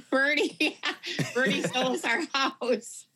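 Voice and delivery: laughing through the name; giggling while talking. Real, not acted. Laughing at realtor coincidence.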